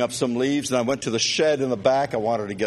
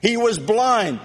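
preaching; 7 semitones higher, slower than usual. Acted. Preacher telling of Bartimaeus crying out, building preaching. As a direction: hammer each line home from the pulpit.

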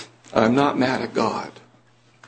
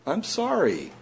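ordinary talk

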